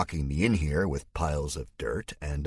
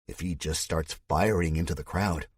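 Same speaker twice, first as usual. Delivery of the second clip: ordinary delivery, nothing marked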